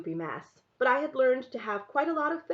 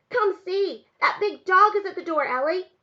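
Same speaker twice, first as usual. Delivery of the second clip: put-on loud, high little boy calling out; excited shouting; 8 semitones higher, 6 dB louder than usual. A narrator's reading. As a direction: burst in with urgent child's news. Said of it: "Samuel came running, shouting".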